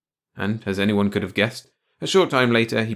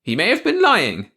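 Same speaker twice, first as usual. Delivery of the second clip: high, loud wifely retort; prompt retort; 11 semitones higher, 7 dB louder than usual. A narrator's reading. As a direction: answer back at once, pitched up. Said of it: "Clara said promptly".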